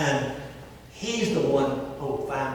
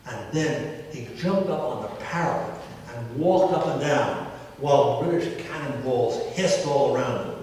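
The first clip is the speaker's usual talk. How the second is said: ordinary talk, nothing marked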